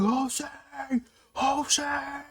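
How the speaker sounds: high calling out across the stands; belligerent yelling. Retold or imitated. Fan calling to the player coming down the steps, high belligerent yell. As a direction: holler his name to get his attention. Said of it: "Super belligerent".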